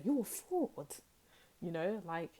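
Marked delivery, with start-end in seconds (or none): 0.0-0.9 sister's line. Retold or imitated. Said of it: "as my sister likes to say".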